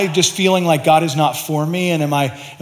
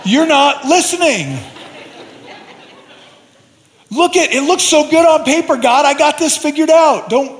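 arguing with God; 10 semitones higher than usual. Retold or imitated. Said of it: "I'm like".

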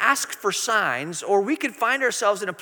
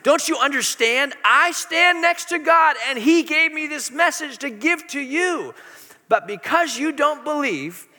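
higher and louder, each word pressed hard; emphatic angel rebuke; 6 semitones higher, 5 dB louder than usual. Retold or imitated. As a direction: Gabriel scolding the doubting priest with force. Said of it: "you kinda feel the emphasis".

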